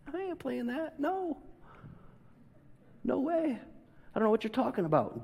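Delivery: high squeaky hammed-up denial; mock panicked denial. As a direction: play a frightened Peter brushing her off. Peter denying Jesus, high voice.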